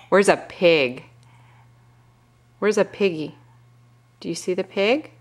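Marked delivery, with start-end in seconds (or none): none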